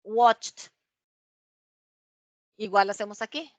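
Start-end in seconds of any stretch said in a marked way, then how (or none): 0.0-0.7 Watched said as one syllable ending in a "t" sound; model pronunciation. Shown as a demonstration. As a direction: say Watched like a teacher modelling it, "wotcht". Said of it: "vamos a pronunciarlo así". (Watched como modelo, terminado en sonido "t")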